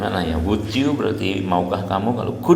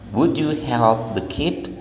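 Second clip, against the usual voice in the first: put-on "Would you help the kid?" with stress on "Would" and a rise at the end; rising question tune; 3 semitones higher than usual. Shown as a demonstration. Teacher showing students a yes-no question rising at the end. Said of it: "Jadi tanya".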